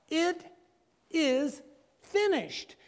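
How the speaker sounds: a slow, high cry. Retold or imitated. Preacher voicing Jesus crying out on the cross, slow and high. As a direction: stretch it out high, the last words from the cross. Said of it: "Jesus was able to cry out".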